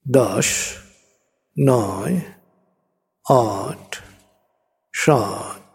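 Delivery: slow, soothing hypnotic countdown; guided meditation. Acted. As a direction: lead them down gently, step by step.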